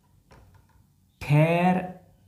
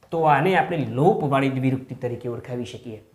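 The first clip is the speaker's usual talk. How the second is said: ordinary talk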